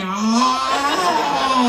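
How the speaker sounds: high, long-held wail; both yelling. Acted. Imitating couple yelling through a cramp, high wail. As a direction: howl it out, drawn long. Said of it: "I know you're both yelling".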